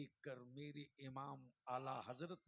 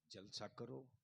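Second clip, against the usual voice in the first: usual speaking voice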